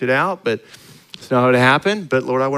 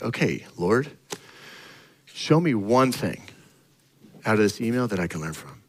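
softer, lowered prayer to God; quiet prayer; 5 semitones lower, 5 dB quieter than usual. Retold or imitated. Pastor praying over a hateful email, softly. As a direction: pray it under your breath.

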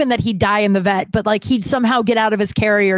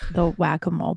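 usual speaking voice

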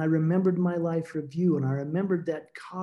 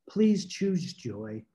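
slow gentle plea; much slower than usual. Retold or imitated. Late wife gently begs her grieving husband, slow and tender. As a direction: coax him slowly toward joy.

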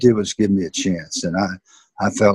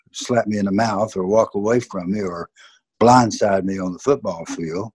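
usual speaking voice

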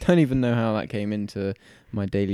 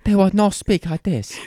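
higher-pitched put-on Chinese accent; mock Chinese accent; 8 semitones higher, 4 dB louder than usual. Showing co-host how a foreigner wouldn't talk, mock Chinese accent. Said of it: "speak in the accent of wherever they're".

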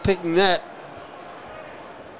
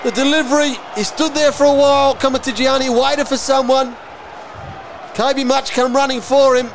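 calling the action, fired up; excited commentary; 6 semitones higher than usual. Real, not acted. Goal run, caller lifts.

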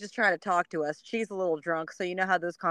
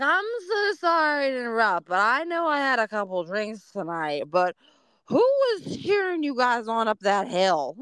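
higher, chatty tipsy lady; tipsy neighbor; 5 semitones higher, 3 dB louder than usual. Retold or imitated. Drunk neighbor asking the runners who cheered, higher. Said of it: "And she's like".

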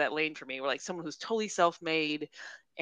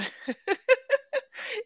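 laugh. Real, not acted. Laughs at own bad accent.

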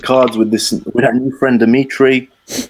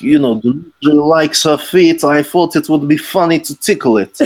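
Dimitri voice; 6 semitones higher than usual. Retold or imitated. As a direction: play creepy Dimitri innocently. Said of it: "He's like".

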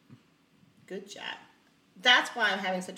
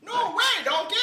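movie quote; 9 semitones higher than usual. Retold or imitated. Quoting Shrek movie line.

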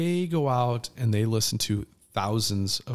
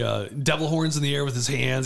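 ordinary delivery

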